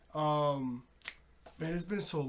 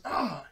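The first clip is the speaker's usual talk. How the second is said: short loud mock yelp, pitched up; cry of pain; 4 semitones higher, 6 dB louder than usual. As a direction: imitate a burned villain crying out. Voices a burned character's cry.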